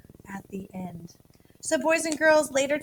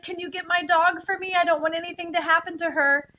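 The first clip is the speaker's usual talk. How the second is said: nervous plea; 6 semitones higher, 4 dB louder than usual. Retold or imitated. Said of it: "strong emotion to let people know I'm kinda nervous".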